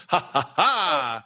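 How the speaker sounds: slow, drawn-out fake "ha ha ha"; mock gloating laugh. Co-host teases the DJ about his strutting, mock gloating. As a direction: imitate a strutting show-off's laugh. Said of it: "I've seen you do that".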